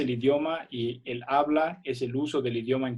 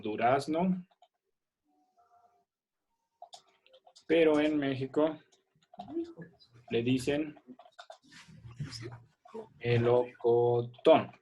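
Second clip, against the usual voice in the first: slow dictation; 3 dB quieter, slower than usual. Shown as a demonstration. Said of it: "vamos a poner subrayado".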